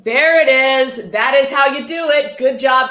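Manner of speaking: loud slowed mock praise; mock cheer. Real, not acted. Streamer mockingly cheering a quote, loud and drawn out. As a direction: cheer it on like a proud coach.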